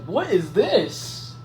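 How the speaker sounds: high, drawn-out disbelieving cry; mock astonishment. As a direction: stretch it out, swooping up in acted amazement. Retold shock at sprouting tail.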